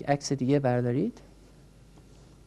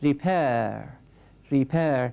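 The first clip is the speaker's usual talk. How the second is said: ریپر with the weight on its second syllable; stress on second syllable; much slower than usual. Shown as a demonstration. Teacher models the word for students, stress on second syllable of ریپر. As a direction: say ریپر leaning on the final syllable, re-PAIR. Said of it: "تأکید کلام من روی سیلاب دوم هست".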